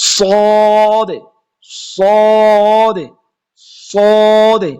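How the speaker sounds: "সদে" stretched long with a thick, heavy s; thick sad. Shown as a demonstration. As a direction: draw the "সদে" out slow and heavy. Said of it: "সদে উচ্চারণ বের হবে না".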